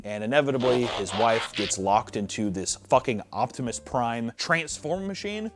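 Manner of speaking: ordinary talk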